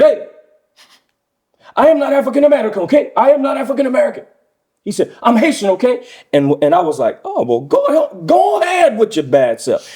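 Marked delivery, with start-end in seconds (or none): 0.0-4.2 high, loud, fast indignant protest; retold cussing out. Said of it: "started cussing him out". 5.2-6.0 high-pitched mock indignation; retold indignant retort. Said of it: "started cussing him out". 7.2-9.9 high, approving retold cheer; gladly cheering on. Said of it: "I was glad for him".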